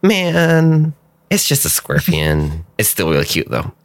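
lightly voiced cute-struck character; a touch of an excited game character; in-character creature. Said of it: "And she's like".

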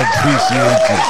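very high squeaky thanks. Pretend. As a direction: squeak it out playfully. Squeaky thanks after the birthday song.

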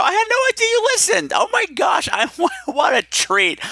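shrieking with fan excitement; starstruck squeal. Real, not acted. Starstruck by celebrity call.

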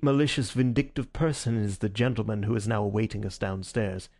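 usual speaking voice

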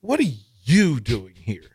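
mock-incredulous question leaning on "you"; imitating incredulity. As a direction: retell it as a baffled "what are YOU". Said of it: "incredulous look on his face".

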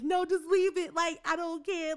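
put-on upset teen brushing off her friend after dropping the cake; upset snap. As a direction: brush the friend off, close to tears.

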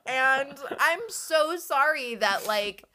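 slow, loud, high fake-sweet apology; sarcastic sugary sympathy; mock sympathy.

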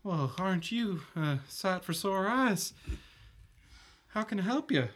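put-on high little-boy voice; smitten young boy. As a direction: play a young lad taken with a lady. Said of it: "young, young boy".

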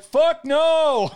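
high loud mock refusal; defiant refusal. Kid refuses the coach, loud high defiant. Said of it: "I was like".

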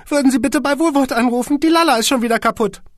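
high, near tears; plaintive squeaky boss. A narrator's reading. Boss calls his secretary, high and close to tears. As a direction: whine her name. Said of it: "war den Tränen nahe".

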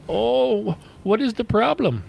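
high, surprised mock Indian accent; mock Indian agent. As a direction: play the puzzled agent. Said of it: "retold agent reply".

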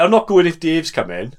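high, loud Valleys grumble; a loud Welsh Valleys sulk; welsh valleys accent. Pretend. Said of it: "that's my joke".

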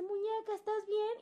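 high, tender call through the door; gentle mentor. Retold or imitated. Mentor knocking on bathroom door, asking gently in a high voice. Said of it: "me toca la puerta y me dice".

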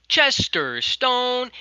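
long drawn-out high call of a name. Retold or imitated. Kyle calling out to Chester as he walks past, a long high shout. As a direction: stretch the name out and call it across the lot.

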